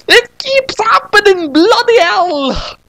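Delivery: high cartoon yelp of a girl bouncing down stairs; falling girl yelp. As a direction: squeal it out mid-tumble down stairs. Sayaka's falling voice.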